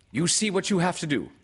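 high, loud, quick character voice; Rabbit. Acted. Rabbit telling Pooh what to do, high and brisk.

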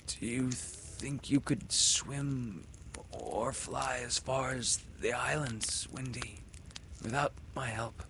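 soft, weak voice. A narrator's reading. Wounded Peter asking softly.

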